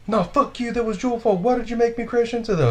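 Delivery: high-pitched mock rant of the crashed driver; angry driver. Teller mimicking the crashed van driver, high indignant rant. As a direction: ham up the driver's indignant whine.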